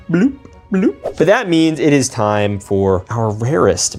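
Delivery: ordinary talk, nothing marked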